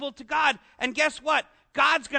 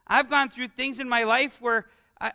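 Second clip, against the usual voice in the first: ordinary talk, nothing marked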